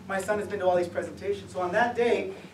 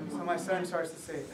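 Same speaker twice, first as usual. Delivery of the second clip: usual speaking voice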